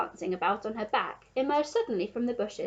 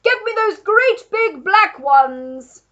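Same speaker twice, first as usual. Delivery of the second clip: slow, high, very loud demand; man shouting; 10 semitones higher, 14 dB louder, much slower than usual. A narrator's reading. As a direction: bellow the order slowly up the tree. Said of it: "the man was shouting".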